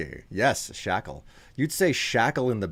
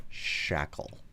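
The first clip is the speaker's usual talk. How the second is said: whispered; 6 semitones lower, 8 dB quieter than usual. Acted. Whispering a kinky S word.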